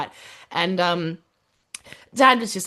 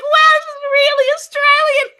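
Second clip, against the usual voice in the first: very high, loud, slow mock-amazed squeal; 7 dB louder than usual. Tells the host her Christmas reaction, high mock-amazed squeal. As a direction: squeal it like you can't believe it.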